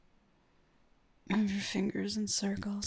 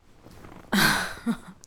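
whispered giggle; 9 dB louder than usual. Acted. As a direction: giggle playfully under the breath. Giggle while moving closer.

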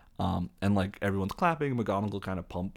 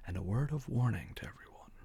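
Dumbledore; 3 semitones higher, 4 dB quieter than usual. Retold or imitated. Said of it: "Dumbledore goes".

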